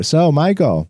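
mock knowing little girl; imitating a little girl. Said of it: "she'll remind you".

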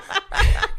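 high airy burst of laughter; breathless high laugh. Real, not acted. Laughs at burrito joke.

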